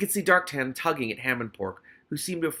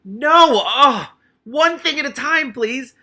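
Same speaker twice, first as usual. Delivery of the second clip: much louder and higher, real frustration; exasperated outburst; 13 semitones higher, 14 dB louder, slower than usual. Real, not acted. Reader crying out at being interrupted, exasperated. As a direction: cry out at the interruption.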